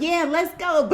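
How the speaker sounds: high, pumped-up "let's go" like a drummer hyping a crowd; hyped cheer. Pretend. Tarot reader pretending to be drummer Joey, hyped cheer. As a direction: cheer it out as if banging a drum. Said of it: "I wish I was like Joey... I'd be like".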